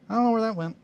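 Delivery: high, loud surprised cry; startled. Real, not acted. Builder losing a tiny piece, startled. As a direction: yelp as the piece vanishes. Part flies off, lost.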